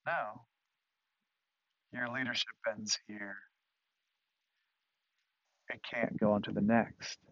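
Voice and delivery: soft, measured refusal; quiet verdict. Acted. God ending the leadership of Moses, quietly. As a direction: say it gently but finally. God's answer to Moses, voiced softly.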